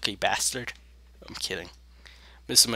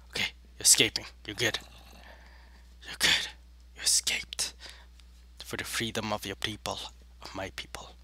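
whispering; 4 semitones lower, 13 dB quieter than usual. Real, not acted. Sneaking and escaping quietly.

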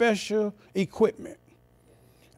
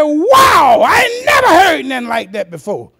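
loud, astonished exclaim; mock-wowed, loud; amazed; 7 semitones higher, 9 dB louder than usual. Young man amazed by the man's teaching, loud.

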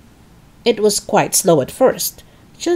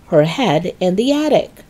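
usual speaking voice

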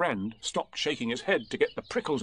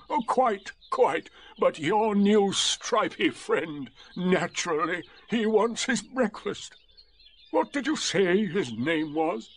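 slow, high, sarcastic donkey voice; drawn-out wounded politeness, pitched up; gloomy donkey; 8 semitones higher, slower than usual. A narrator's reading. Donkey sizing up the stripy friend, slow and sardonic.

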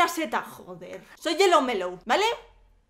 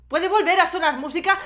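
louder, higher, losing patience; exasperated plea; 5 semitones higher, 10 dB louder than usual. Pretend. Vlogger asking for the music back, exasperated raised voice. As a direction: demand it with rising urgency. Pleading escalates as music is cut.

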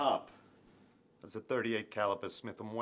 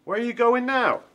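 loud, high call after someone; shouting; 16 dB louder than usual. Acted. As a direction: yell across the room. Calls after partner leaving.

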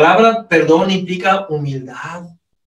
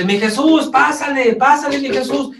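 friendly doctor calling in; 8 semitones higher than usual. Retold or imitated. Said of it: "Me dice".

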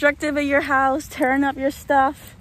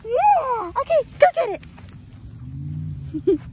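high, bright praise for the dog, much higher pitch; excited dog praise; 12 semitones higher than usual. Real, not acted. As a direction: cheer the dog on as she runs for the ball. Excited praise, sending dog for ball.

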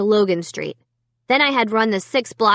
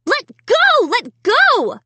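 very high, loud frantic cries; desperate high-pitched yelling; shrieking; 4 dB louder, slower than usual. A narrator's reading. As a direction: shriek the order in panic. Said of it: "I shrieked".

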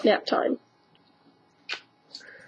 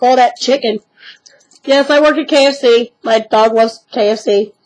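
ordinary delivery

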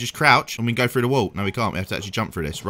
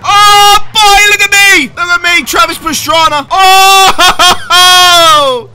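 much higher and louder, gleeful showing-off; excited bragging; 12 semitones higher, 11 dB louder, slower than usual. Real, not acted. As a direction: brag like you just won a rally. Showing off his driving.

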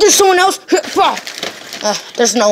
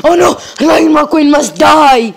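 villain shout; 5 semitones higher, 5 dB louder than usual. Acted. Villain role shouting at rival.

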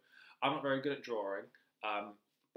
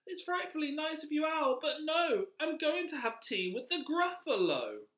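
high-pitched mouse voice; little mouse; 5 dB louder than usual. Acted. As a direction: answer as a polite, squeaky little mouse. Mouse answers owl in story.

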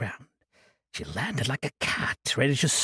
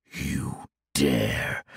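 low growl; 10 semitones lower than usual. A narrator's reading. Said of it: "Ossex growled".

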